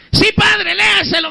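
high, pleading woman's voice urging the priest; lightly voiced pushy parishioner; eager churchwoman.

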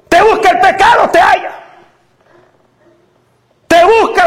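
preaching at full force. Acted. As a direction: hammer home each line. (predicación a gritos, con estribillo rítmico)

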